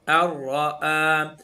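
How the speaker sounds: अर्रआ merged, drawn out slowly; idgham demo. Shown as a demonstration. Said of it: "यहाँ पर इदगाम हो रहा है".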